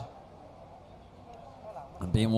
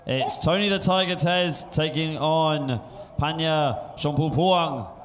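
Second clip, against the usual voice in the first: fight announcer intro; 4 semitones higher than usual. Real, not acted. Commentator introducing the main event to viewers, big announcer delivery. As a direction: build up the title fight, landing hard on the names.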